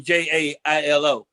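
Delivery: spelling J A I L O letter by letter; spelled out. Shown as a demonstration. Comic spelling jail out for the crowd, letter by letter. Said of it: "expects that to go down in".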